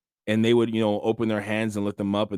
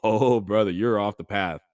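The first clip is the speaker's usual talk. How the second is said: smiling mock insult; 3 dB louder than usual. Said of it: "You can smile at somebody and go".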